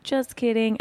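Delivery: high-pitched mock confession; playful reveal. MC revealing herself to the crowd, playful. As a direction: toss it off high, as a joke reveal. Acting out her reveal to audience.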